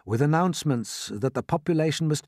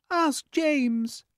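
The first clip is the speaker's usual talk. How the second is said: very high ladybird voice; ladybird character. Acted. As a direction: suggest it in a high insect voice. Ladybird speaks in story.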